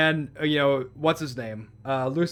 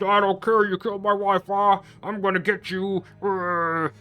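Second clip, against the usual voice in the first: hammed-up furious warrior, pitched higher; mock angry; 4 semitones higher than usual. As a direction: imitate an enraged attacker, voice put on and raised. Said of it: "He's too angry. He's just, like, wailing on him".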